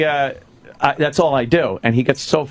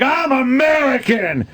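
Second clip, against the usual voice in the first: higher, slow cocky yell; brash American; 10 semitones higher, slower than usual. Retold or imitated. Mocking the rowdy American in the old Canadian ad.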